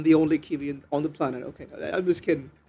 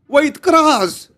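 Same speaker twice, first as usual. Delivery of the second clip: higher, louder call to stop; yell; 9 semitones higher, 9 dB louder than usual. Acted. Zark interrupts Kraz, a mildly acted yell. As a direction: cut him off with a sharp call. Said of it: "Zark yelled".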